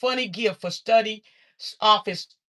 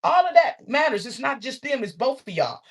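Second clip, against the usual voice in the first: ordinary talk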